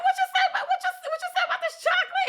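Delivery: very high, loud, rushed and laughing; hammed-up delighted squeal. Retelling her comeback to Turbo's compliment, high excited mock squeal. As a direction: squeal it fast, tripping over the repeats.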